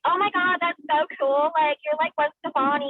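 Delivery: high-pitched imitation of gushing friends; gushing girlfriends. Girlfriends admiring her bindi, high gushing imitation.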